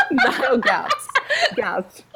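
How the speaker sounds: real laughter breaking into the reading; laughing narration. Real, not acted. Reader losing it mid-story, narrating through laughter. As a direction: read on while still cracking up.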